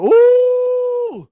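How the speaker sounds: long, high, loud "ooh"; excited whoop. Real, not acted. Host cheering a viewer's Amex Gold approval, excited high whoop. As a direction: let out a drawn-out celebratory hoot.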